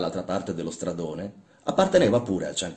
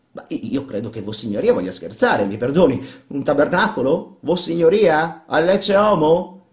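higher, louder, rising questions; incredulous; 7 semitones higher, 7 dB louder than usual. Acted. Workman questioning his irreligious master, incredulous. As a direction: draw out each question in disbelief.

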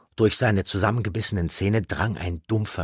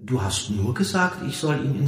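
small, embarrassed self-defence; abashed, light voice; sheepish; 6 semitones higher than usual. A narrator's reading. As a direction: excuse yourself meekly. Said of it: "antwortete sie betreten".

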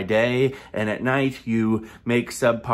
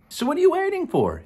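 ad hype. Acted. Ad hype call.

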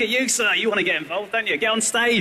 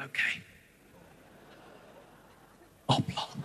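timid whisper; 15 dB quieter, slower than usual. Retold or imitated. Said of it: "they go".

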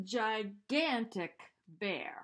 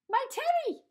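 much louder, far higher cry; 11 dB louder than usual. A narrator's reading. Eddie sees his teddy, loud high cry. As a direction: cry out grabbing for it. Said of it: "cried Eddie".

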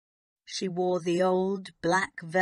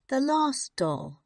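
put-on high, wistful child's voice; gently mournful, higher voice; soft sadness; 7 semitones higher, 4 dB louder than usual. A narrator's reading. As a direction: say it sadly, pitched well up. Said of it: "she said softly".

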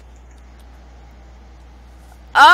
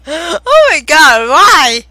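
shocked; 6 semitones higher, 9 dB louder than usual. Real, not acted. Shocked by friend's guess.

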